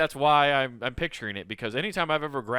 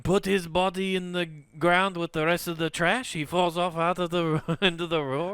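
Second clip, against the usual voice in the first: slightly raised mock-French voice; French accent; 4 semitones higher than usual. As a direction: dismiss them in a French accent. Acting French onlookers.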